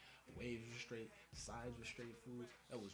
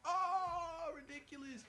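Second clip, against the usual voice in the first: high, loud cry of pain; pained wail; 9 dB louder, much slower than usual. Retold or imitated. Customer crying out as edges cut his head, high wail. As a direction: wail it like it really hurts. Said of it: "I was like".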